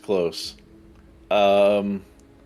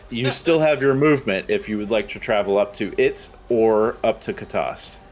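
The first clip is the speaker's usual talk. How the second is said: ordinary talk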